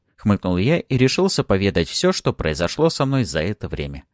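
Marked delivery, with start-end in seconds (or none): none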